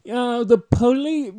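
high, loud stab at Boston; hammed-up Boston opener; attempted Boston accent. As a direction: try out a mock Boston accent. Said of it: "Maybe I should do a Boston accent".

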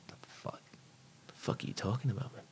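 hushed, disbelieving mutter; very soft reenacted disbelief; stunned whisper. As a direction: question it under your breath, appalled. Retells stunned reply to girlfriend.

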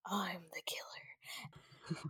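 slow, breathy villain whisper; sinister whisper. Retold or imitated. Billy revealing himself to Sydney, slow creepy whisper. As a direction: drop to a menacing whisper. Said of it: "Billy completely changes his key from, to".

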